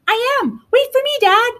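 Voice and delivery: high, eager youngster's voice; little dinosaur. A narrator's reading. Little dinosaur calling after his dad, high pleading. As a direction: call after him in a high child voice. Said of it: "said the little dinosaur".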